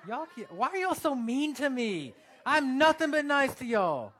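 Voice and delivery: loud, high, hammed-up whine; mock hurt complaint. Preacher to the church, loud mock-wounded whine. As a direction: play the wounded preacher, wailing.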